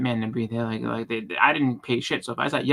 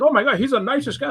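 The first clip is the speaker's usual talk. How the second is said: gushing fans; 12 semitones higher, 3 dB louder than usual. Retold or imitated. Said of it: "People like".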